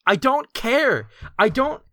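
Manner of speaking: high, loud screaming rage; furious shout. Acted. Mandy yelling at Papa Scuff to fix this, furious. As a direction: scream him down.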